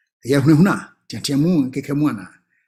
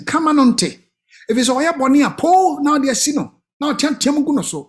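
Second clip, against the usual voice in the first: put-on higher, pleading teen voice; teen talking back; 8 semitones higher than usual. Teen pleading with auntie, higher voice. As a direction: play the youngster begging.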